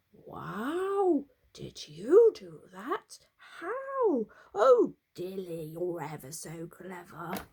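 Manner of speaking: can't believe it; amazed. A narrator's reading. Owner astonished at the clever donkey.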